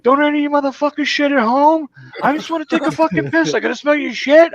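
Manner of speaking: high-pitched ranting yell; yelling at coworkers. Retold or imitated. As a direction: re-enact chewing out the whole office. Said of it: "always yelling at people at work".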